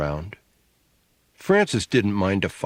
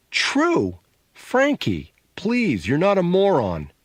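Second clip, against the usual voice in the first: high, disbelieving exasperation; high-pitched scornful disbelief; incredulous scoffing; 9 semitones higher, slower than usual. A narrator's reading. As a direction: echo it in disbelief, pitched way up, then plead with them to be sensible. Sister scoffs at brother's claim.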